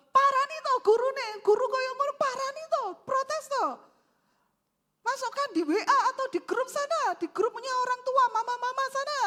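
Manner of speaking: high-pitched, loud husbandly tirade; worked-up, emotional. Acted. Husband ordering wife to confront the teacher, worked-up. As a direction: fire off orders as a hammed-up indignant husband. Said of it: "ojo emosi".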